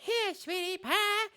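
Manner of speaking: put-on very high, slow baby talk; sickly sweet voice. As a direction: coo at the gun like a pet. Mock sweet voice to gun.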